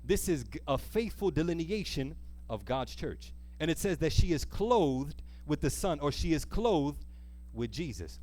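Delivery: ordinary delivery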